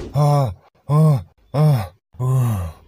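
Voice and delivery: heavy breathy panting, four puffs. Acted. Gong has run as if chased by a ghost, panting. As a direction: pant hard between strides.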